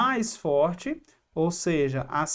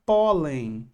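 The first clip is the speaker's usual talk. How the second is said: pólen said slowly, PÓ stressed; stress on penultimate syllable; 3 semitones lower, 3 dB louder than usual. Shown as a demonstration. Teacher showing the tonic syllable, pólen stressed on PÓ. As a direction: lean long on the PÓ of pólen. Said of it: "Penúltima sílaba mais forte".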